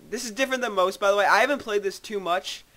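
ordinary talk

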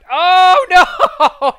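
high, loud panicked oh no; alarmed cry. Real, not acted. Near death in game.

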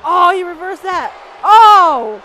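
loud, high cry of dismay; alarmed gamer shouting; dismayed outcry. Real, not acted. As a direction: wail as the move backfires. Move reversed in game.

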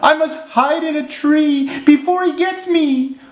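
very high frightened squeal; squeaky panic, stretching out the final pleas; panicked elephant shout. A narrator's reading. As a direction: plead in cartoon terror, high-pitched. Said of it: "shouted the elephant".

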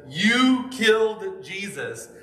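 Peter's bold closing charge; loud accusation. Acted. Preacher voicing Peter accusing the crowd, loud and slow. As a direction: point and declare it, high and slow. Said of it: "loudly says".

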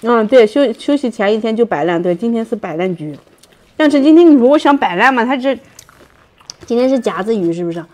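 biting crispy crust; chewing while talking. Real, not acted. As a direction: mouth partly full. Seen 0: Bites the crust piece between phrases and talks around her chewing.

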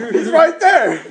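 high, loud mock outburst; hammed-up retold shout; imitated exclamation. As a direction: mimic his excited cry. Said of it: "he's like".

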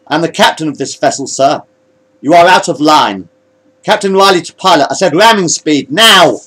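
high, loud clash of officers; heated command dispute. Acted. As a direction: pull rank, building to a loud order. Officers quarrel over command.

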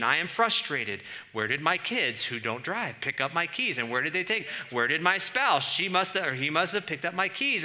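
rising exasperation at the lost keys; hammed-up frustrated ranting; vexed.

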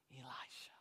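a breathless whisper of the name; whispered climax. Acted. Preacher ending the story's climax in a whisper. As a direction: let the name fall to a whisper. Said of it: "dramatic climax of story".

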